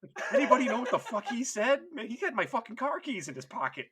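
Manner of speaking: high, loud, exasperated teammate; mock panicked complaint. Teammate left without a ride, comically panicked protest. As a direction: rant like you've just been stranded.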